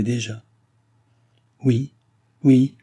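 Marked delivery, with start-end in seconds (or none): 1.6-2.8 louder, higher exasperated outcry; exasperated cry. A narrator's reading. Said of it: "s'écria Nicole exaspérée".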